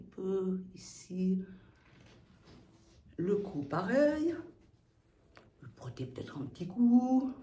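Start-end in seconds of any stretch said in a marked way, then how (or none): none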